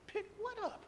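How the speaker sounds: incredulous. Retold or imitated. Incredulous reply to boss.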